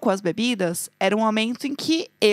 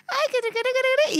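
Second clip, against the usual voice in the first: very high stammering que que que; mock flustered Englishman; 12 semitones higher than usual. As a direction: play a flustered man sputtering. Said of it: "Aí ele tava".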